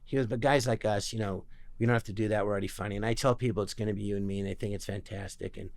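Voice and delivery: low, soft retold director; understated, confiding murmur; quiet reassurance. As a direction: take an actor aside, softly and low.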